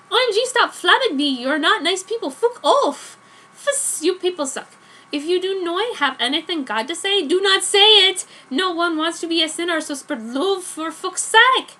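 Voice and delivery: put-on high, loud teenage tantrum at the haters; angry rant. Author yelling at reviewers who flamed her story, loud angry rant. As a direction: snap back at the mean reviewers, full outrage.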